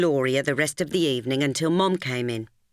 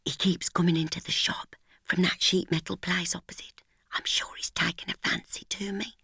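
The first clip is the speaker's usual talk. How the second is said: soft unvoiced secret whisper; a hushed confiding whisper; 3 semitones higher, 5 dB quieter than usual. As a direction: breathe it into her ear, glancing back. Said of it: "A whisper tickled my ear".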